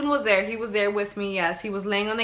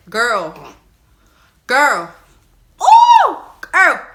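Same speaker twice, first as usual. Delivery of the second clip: dramatic build-up; 3 semitones higher, 12 dB louder, much slower than usual. Real, not acted. Telling viewers the shocking part, excited build-up. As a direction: build the suspense, louder each time.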